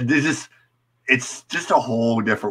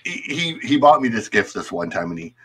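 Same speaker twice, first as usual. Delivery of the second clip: usual speaking voice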